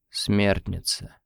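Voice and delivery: slow, low, strained croak; strained zombie voice. A narrator's reading. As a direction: squeeze the word out like a zombie. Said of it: "сдавленно произнесла Даша".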